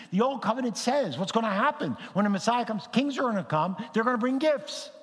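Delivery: high, driving pulpit delivery; emphatic preaching. Real, not acted. Preacher telling congregation of Old Covenant prophecy, emphatic preaching. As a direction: proclaim it with rising excitement.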